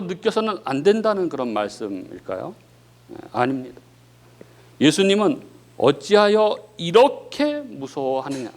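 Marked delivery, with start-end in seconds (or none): 5.8-8.5 slow, measured rebuke with a changed timbre; slow gentle rebuke. Acted. Jesus asks the frightened disciples, slow gentle rebuke. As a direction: draw each word out as a gentle question. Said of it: "이렇게 말씀하셨습니다".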